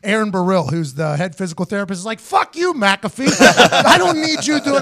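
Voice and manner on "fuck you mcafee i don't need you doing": 1 mock outburst. Physical therapist's mock outburst.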